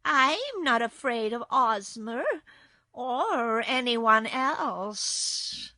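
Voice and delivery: loud, slow, drawn-out cat voice; defiant kitten. A narrator's reading. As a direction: boast slowly as a haughty cat. Surly kitten boasts defiantly.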